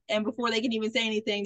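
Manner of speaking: ordinary delivery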